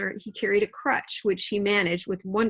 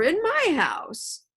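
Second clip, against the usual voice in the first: high, slow indignant cry; Silver's scandalised slow outburst; outraged cry; 9 semitones higher, much slower than usual. A narrator's reading. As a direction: stretch it out in outrage. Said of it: "cried Silver".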